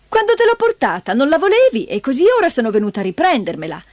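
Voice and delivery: high, rapid, confrontational woman; high-pitched indignant aunt; brash aunt. A narrator's reading. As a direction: reproach him quickly and insistently.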